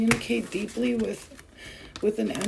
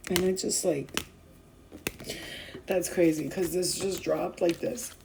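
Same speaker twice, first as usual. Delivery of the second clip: normal talking voice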